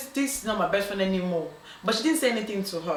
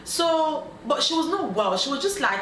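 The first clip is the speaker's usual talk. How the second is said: usual speaking voice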